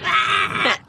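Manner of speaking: squeaky door noise, imitated; drawn-out creaky baby-like wail; imitated squeak. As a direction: mimic a door that sounds like a crying baby. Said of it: "Well, it sounded like".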